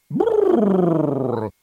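loud, high shiver noise; shivering brrr. A narrator's reading. Clown mimics shivering.

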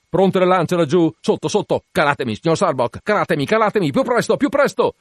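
loud, high, climbing to the end; urgent shouted orders. A narrator's reading. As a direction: hurry the crew with mounting urgency. Captain demands to be lowered, hurrying.

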